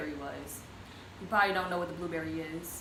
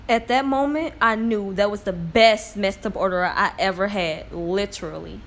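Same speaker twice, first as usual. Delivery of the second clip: excited; 9 dB louder than usual. Real, not acted. Raving about first bite of food.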